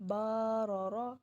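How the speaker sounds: بارہ را said slowly, the vowels stretched long; demonstrating the error of stretching بارہ را slowly; drawn-out vowel. Shown as a demonstration. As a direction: drag out بارہ را as the mistaken reading. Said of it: "یہ ہم نے کھنچاؤ پیدا کر دیا".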